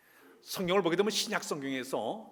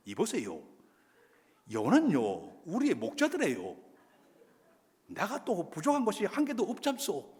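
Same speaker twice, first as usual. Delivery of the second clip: Gangwon dialect; 7 semitones higher, 5 dB louder than usual. Retold or imitated. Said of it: "강원도 사람들은".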